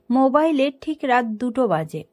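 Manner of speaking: ordinary delivery, nothing marked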